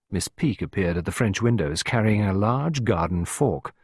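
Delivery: ordinary delivery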